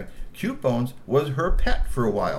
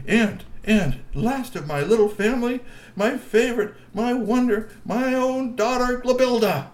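doting troll husband; 12 semitones higher, 5 dB louder than usual. Acted. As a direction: gush over his wife grandly. Glub presents his daughter.

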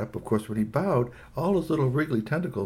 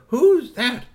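put-on louder, high-pitched child demand; second little girl; 13 semitones higher, 8 dB louder than usual. A narrator's reading. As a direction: pipe it up loudly like a nosy kid. Other girl asks, louder.

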